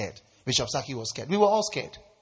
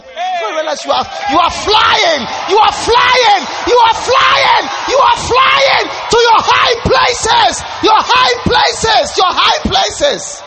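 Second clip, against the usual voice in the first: much higher, louder, slowing triumphant proclamation; rising preaching climax; 8 dB louder than usual. Acted. Pastor promising the congregation they will fly, preaching at full force. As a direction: build to a soaring shout.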